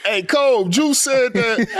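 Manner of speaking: high teasing call-out, hammed-up; mock tattling call. Nick telling Kobe on a teammate, high mock tattling call.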